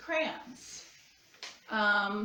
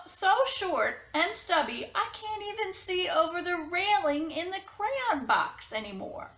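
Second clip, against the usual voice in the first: a touch higher, tired worn-out crayon; a lightly voiced crayon pleading for a break; blue crayon letter voice; 6 semitones higher, 3 dB louder than usual. To Duncan, a worn-down crayon asks for a rest.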